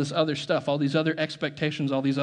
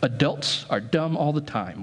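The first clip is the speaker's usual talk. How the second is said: normal talking voice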